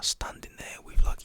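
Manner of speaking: ordinary talk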